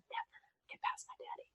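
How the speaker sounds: breathy sing-song whisper; teasing whisper. Acted. Teen taunting the billionaire, whispered and teasing. Whispered echo of own line.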